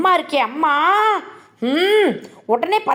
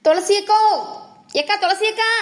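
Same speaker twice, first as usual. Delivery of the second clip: calling out; 3 semitones higher than usual. Acted. Younger sister summoning her elder sister Thulasi, calling out. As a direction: call for big sister from another room. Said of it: "நெட்டலைக்கு கத்துறா".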